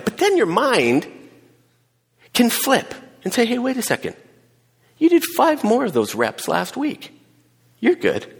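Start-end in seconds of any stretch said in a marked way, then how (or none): 3.5-8.3 encouraging inner voice. Acted. Mind pushes on.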